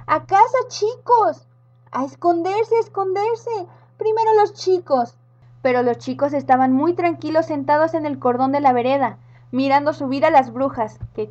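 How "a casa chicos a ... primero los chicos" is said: grown-ups flee the witches, panicked shouting; panicked adults. Acted. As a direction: yell to the kids in panic.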